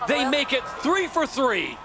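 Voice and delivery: real excitement as the winning boat crosses; excited win call. Real, not acted. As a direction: shout the result across the finish line. Calling the race winner.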